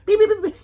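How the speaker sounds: high, loud beeps; watch beeping. Retold or imitated. Her watch warning about her heart, high mock beeping. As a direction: imitate a smartwatch alarm. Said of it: "my little watch was beeping".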